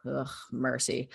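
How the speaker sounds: usual speaking voice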